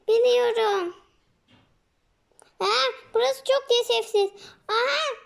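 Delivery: high, pleading squeaky voice; a small creature whining at the cannibals; frightened little creature. Acted. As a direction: beg for your life as a tiny captive. Captured miniş pleads with cannibals.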